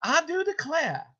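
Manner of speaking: high, slow Southern drawl; exaggerated Southern demo, R dropped; Southern accent. Shown as a demonstration. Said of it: "if you're from the South".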